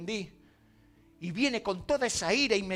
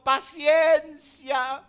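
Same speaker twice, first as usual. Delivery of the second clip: drawn-out patience; 3 semitones higher, 8 dB louder, much slower than usual. Acted. Pastor answers impatient church member.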